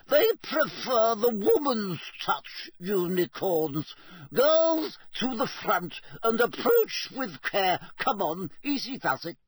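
high, slow, commanding teacher; brisk teacher voice. Acted. Teacher beckoning the girls toward the unicorn, high and measured. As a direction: call the girls forward with care.

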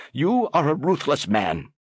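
put-on high accusing old man; accusing ambassador. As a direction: point the finger and accuse.

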